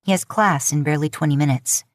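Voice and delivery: ordinary delivery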